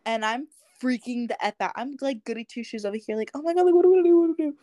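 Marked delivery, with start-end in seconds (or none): 3.3-4.6 high, loud frantic panic; mock panic at the cop car, voice jumping up; reliving a teen freak-out, loud and high; panicked.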